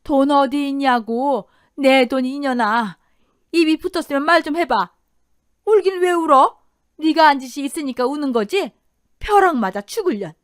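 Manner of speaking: furious mother-in-law hurling abuse; verbal abuse. Acted. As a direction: berate and curse her viciously. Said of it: "저런 폭언과 폭력을".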